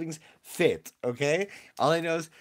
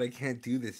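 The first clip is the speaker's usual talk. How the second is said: ordinary talk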